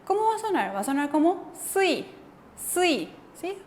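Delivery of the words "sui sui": "sui" with a tense, pressed s; a bit more force. Shown as a demonstration. Teacher modelling Korean double s, extra force on "sui". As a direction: press a little harder into the s of "sui". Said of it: "Como un poquito de más fuerza".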